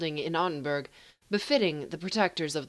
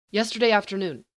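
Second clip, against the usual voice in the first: short; 4 semitones higher, 5 dB louder, faster than usual. Acted. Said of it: "he says shortly".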